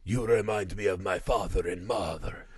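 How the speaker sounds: imitating the old drunk's heartfelt line. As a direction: murmur it like a worn-out old sailor. Old drunk voiced by speaker.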